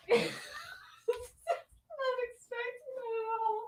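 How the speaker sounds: high, soft squeak after a laughing fit; tearful from laughing. Real, not acted. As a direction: squeeze it out, spent from laughing. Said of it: "I'm not crying".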